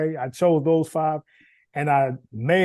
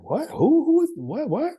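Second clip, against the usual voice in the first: high, bewildered what and who; confused surprise; 11 semitones higher than usual. Retold or imitated. Player baffled by French coach's call, high confused. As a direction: act out not understanding the caller.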